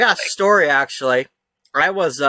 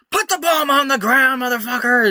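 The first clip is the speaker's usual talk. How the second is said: much higher mock yell of an armed cop; imitated cop scream; 10 semitones higher than usual. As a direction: scream the command with a curse at the end. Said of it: "they're screaming at me".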